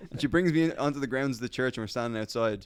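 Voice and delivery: usual speaking voice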